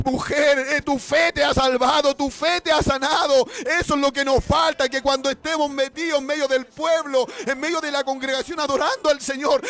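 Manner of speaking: high, loud preaching of the healing story; full-force preaching. Acted. Preacher retelling the healed woman, loud and high. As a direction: preach it with rising fire.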